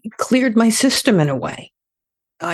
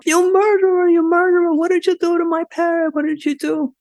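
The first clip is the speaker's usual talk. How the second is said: screaming mother; 14 semitones higher than usual. Retold or imitated. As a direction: shriek at the son like a horrified old mother. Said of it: "the mother starts screaming".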